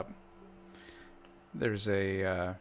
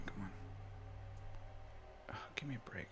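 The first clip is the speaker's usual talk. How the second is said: whispered grumble to himself; whispering; 4 semitones lower, 23 dB quieter than usual. Real, not acted. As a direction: mutter it under your breath. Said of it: "mutters at the laggy launcher".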